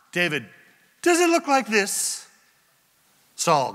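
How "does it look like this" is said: high-pitched calling voice; acted yell. David showing Saul the missing piece, calling down. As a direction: call it down tauntingly from afar. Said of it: "he yells down".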